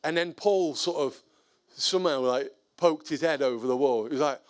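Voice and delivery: normal talking voice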